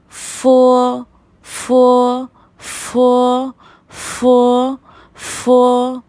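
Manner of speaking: loud फो with a puff of air; strong aspirated फो. Shown as a demonstration. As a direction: repeat फो loudly, air from the lips. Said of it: "इसी तरह से आपको pronounce करना है".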